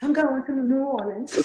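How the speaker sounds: high, laughing try at a Cajun drawl; giggly Cajun attempt at "I'm going to New Orleans". Shown as a demonstration. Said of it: "with that Cajun drawl".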